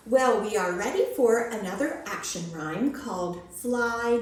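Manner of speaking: ordinary delivery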